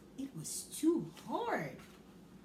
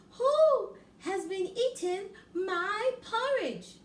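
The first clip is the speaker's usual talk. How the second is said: higher, louder mother bear voice; medium Mother Bear; 9 semitones higher, 7 dB louder, slower than usual. Acted. Mother Bear asks about porridge, higher and louder. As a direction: ask it like a middling mother bear. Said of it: "said in a medium-sized voice".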